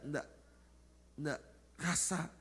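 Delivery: ordinary delivery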